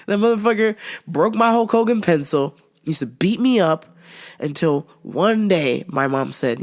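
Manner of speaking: ordinary delivery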